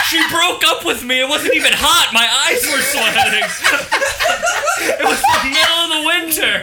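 high teasing mock voice; laughing while mimicking a friend; mocking friend's excuse. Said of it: "Mason doesn't know what crying is".

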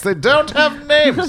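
high, slow and drawn out; high slow sing-song. Acted. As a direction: stretch it out sweetly up high. Drawn out to the rat.

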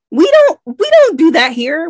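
high-pitched protest, starting loud; mock indignation. Reader objecting to the book's Queen references, mock indignant. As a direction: object as if affronted, pitch leaping up. Objects to foreign wording in book.

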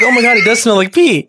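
high-pitched retold exclamation; mock disbelief. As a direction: act out a fake discovery. Acting out their fake reaction.

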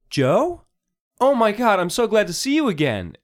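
high, loud rush of joy; delighted greeting. Acted. Malaya running downstairs to reunite with Joe, delighted. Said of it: "you hear a familiar voice".

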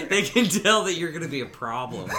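high, fast words through laughter; laughing while talking. Real, not acted. Host joking about dating apps, laughing through it. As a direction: push the line out between laughs.

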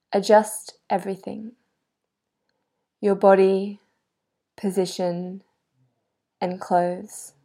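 calm, measured yoga nidra voice with long pauses; guided meditation. Real, not acted. Yoga guide helping a listener get comfortable, guided meditation. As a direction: settle the listener gently, leaving space between phrases.

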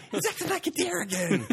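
high, laughing mock yell; sister yelling from another room. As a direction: shriek it from the next room. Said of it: "That's not what she sounds like".

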